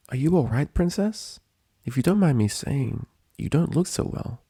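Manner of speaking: anxious student checking on her mentor; worried Twilight. A narrator's reading.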